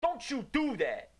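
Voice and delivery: high, slow playful scold; mock scolding. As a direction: tease them with a fake warning.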